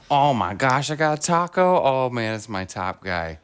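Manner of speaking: ordinary delivery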